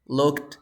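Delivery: "looked" with a weak, D-like ending; soft D ending. Shown as a demonstration. Teacher repeating the second one, "looked" with soft D. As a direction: keep the end of "looked" unexploded. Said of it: "But the second one is".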